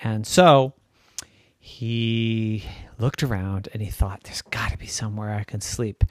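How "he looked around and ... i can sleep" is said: whisper. A narrator's reading.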